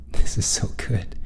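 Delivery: whispered aside. Real, not acted. Excited whispered aside to viewers.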